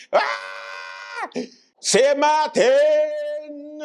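long, high drawn-out call; hammed-up rallying cry; mock triumphant cry. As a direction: stretch the island's name in a high wail. Triumphant politician caricature calls out.

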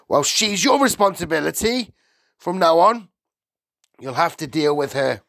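high, loud, dismissive householder; brusque Mr Wormwood. A narrator's reading. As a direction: turn the caller away loudly.

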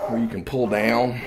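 ordinary talk, nothing marked

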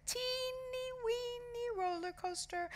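tiny, squeaky-high and soft; mock-cute miniature voice; teeny-weeny voice. A narrator's reading. Mocking a tiny ride.

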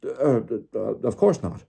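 flustered, stammering backtrack; hesitant, embarrassed stutter; Diggory mumbling. A narrator's reading. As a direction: stumble into a retraction. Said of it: "mumbled Mister Diggory".

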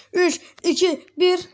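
loud, high, excited countdown to the jump. Real, not acted. Gamer counting down his car jump to viewers, loud and excited. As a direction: count down like a launch is coming.

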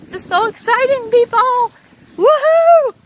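high, fast, loud excited cheer; squealing with real excitement. Real, not acted. Vlogger at the fair squeals to his viewers. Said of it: "I'm just so excited now".

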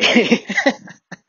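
squeaky high-pitched giggle. Retold or imitated. Mimicking Federer's giggle, high and squeaky. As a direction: let out a tiny high giggle. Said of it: "He would say".

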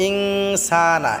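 "ইনসানা" with the "সা" drawn out one alif; long vowel. Shown as a demonstration. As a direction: stretch the "সা" in "ইনসানা" for a full beat. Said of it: "সাটা আমরা এক আলিফ টেনে পড়ব". ("ইনসানা"-র সা এক আলিফ টেনে)

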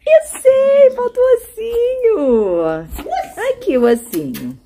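high drawn-out pet talk. Real, not acted. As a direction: coo slowly to the dog. Cooing to dog.

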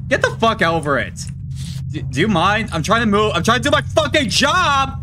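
high frustrated yell at the demon; yell, fed up and interrupted; angry yelling. Real, not acted.

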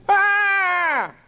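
long, very high-pitched scream; child's scream. A narrator's reading. Small boy dragged from the scene, screaming.

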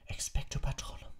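breathless whispered spell; gasped. A narrator's reading. Harry gasps the spell on his knees in the grass. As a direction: gasp it out. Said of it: "keuchte er".

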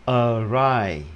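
"Awry" as "ơ-RAI"; schwa start. Shown as a demonstration. Teacher models a word spelt like awful, schwa start. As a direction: open "Awry" on ơ, then a falling "rai". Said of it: "đọc là ơ".

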